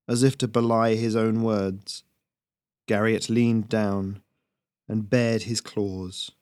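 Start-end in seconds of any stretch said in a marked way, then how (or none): none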